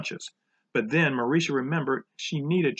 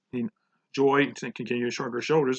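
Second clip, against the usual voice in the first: ordinary delivery, nothing marked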